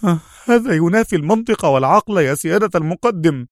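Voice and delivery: huffed, high-pitched protest; exasperated Khaled. A narrator's reading. Khaled objects to his superior officer, exasperated. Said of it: "زفر خالد في قوة".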